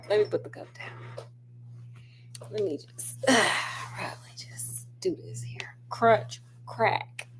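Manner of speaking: real whisper, soft and unvoiced; hushed whisper while handling something. Real, not acted. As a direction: whisper as if not to disturb anyone.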